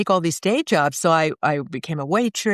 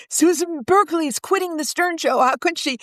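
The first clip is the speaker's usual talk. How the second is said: much higher, mock-scandalized headline read; mock tabloid headline; 11 semitones higher than usual. Quoting the Post's headline, mock-scandalized and high. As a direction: read it like a gossip splash. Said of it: "It actually ended up in the New York Post".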